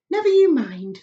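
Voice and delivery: high, loud, cheerful brush-off; brisk dismissal. Acted. Ladybird brushing off James's question, brisk and cheerful. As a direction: wave the question away.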